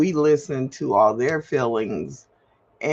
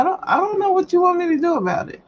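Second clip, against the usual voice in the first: exasperated outburst; 11 semitones higher, much faster than usual. Real, not acted.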